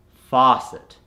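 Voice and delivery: faucet with a long open "ah"; American accent. Shown as a demonstration. Coach gives the converted word, American accent. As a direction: say "faucet" American, as "fah-cet". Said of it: "to the American accent".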